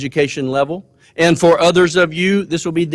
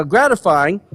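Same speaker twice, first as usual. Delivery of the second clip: ordinary delivery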